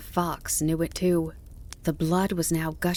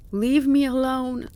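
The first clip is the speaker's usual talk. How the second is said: higher, louder desperate begging; imploring plea; 8 semitones higher, 5 dB louder than usual. A narrator's reading. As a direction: plead as a disheartened, hunted animal. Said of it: "He spoke quietly and imploringly".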